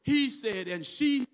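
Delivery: driving, building sermon delivery; full-force preaching. Real, not acted. Preacher urges church members to begin worship, full-force preaching. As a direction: preach with mounting urgency to the congregation.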